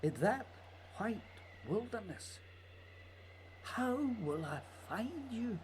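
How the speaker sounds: low, hushed, unhurried lines; hushed poetic narration. Acted. Barry speaking softly to Scott across the ice, hushed. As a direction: speak it like a letter to a lost friend.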